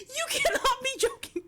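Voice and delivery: high, loud words squeezed through laughter; half laugh, half cry of joy; laughing disbelief. Real, not acted. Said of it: "I have to break character for this".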